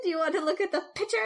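teasing through laughter; giggling while teasing a friend; laughing. Real, not acted. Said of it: "laughing while teasing".

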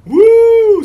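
loud high cheer; excited whoop. Real, not acted. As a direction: whoop with delight. Cheering at the discovery.

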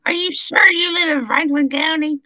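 loud, high-pitched scolding interrogation; hammed-up shrieky yell, high and loud; yelling at people. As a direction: imitate a coworker yelling suspicious questions at customers. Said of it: "she's yelling at these people".

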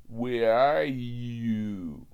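put-on slow, slurred drunk voice; drunk customer voice. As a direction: drag each word like a drunk.

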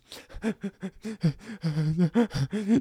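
high, hammed-up sobbing breaths; mock a kid's upset, stuttering sobs; imitated crying. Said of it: "And he was crying".

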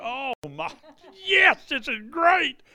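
high, loud giggling delight; laughing with joy. Retold or imitated. Nurse throwing her arms up, high laughing delight. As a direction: laugh it out as the pain is gone. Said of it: "she starts laughing hysterically".

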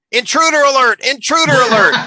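high, loud robot alarm. Retold or imitated. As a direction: blare it like a robot. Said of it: "the robot goes".

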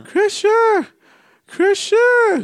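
very high, loud squealing call; Paco impression. Friend mimicking a drunk woman calling out, shrill mock impression. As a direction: shriek for someone in a drunk falsetto. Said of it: "You wanna hear my Paco impression?".

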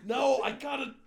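put-on high, loud drunken boast; mock tough-guy shout; macho shout. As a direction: yell it like a drunk guy proving himself.

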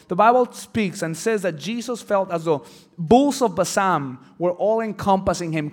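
ordinary talk, nothing marked

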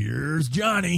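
Here's Johnny. Retold or imitated. Quoting The Shining line.

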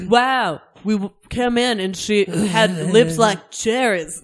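loud, slow mock actor reading; hammed-up celebrity audiobook voice; Matt Dillon. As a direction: draw it out slowly, loud and halting. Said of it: "Is he just like".

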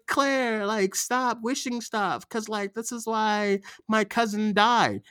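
high, whiny imitation of a movie friend; hammed-up scolding friend; mock teen pleading. Voicing movie friends scolding Claire.

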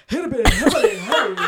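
loud, babbling self-parody; laughing, loud mock babble; radio voice falling apart. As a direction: send up your own hosting with loud gibberish. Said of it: "four minutes in I'm going".

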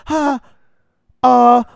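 jolted by a sudden pop, half-hammed; a friend at the party startled by the pop, mock alarmed; startled confusion. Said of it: "everyone's like".

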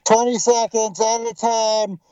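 talking through a face mask, muffled; muffled words behind a mask. Real, not acted. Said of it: "sorry if it sounds a little muffled".

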